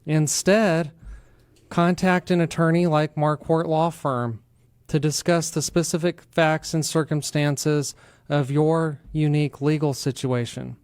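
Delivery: ordinary delivery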